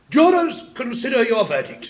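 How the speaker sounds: high loud call to the jurors. Acted. King ordering the jury to decide, loud and high. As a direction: sing it out to the jury box.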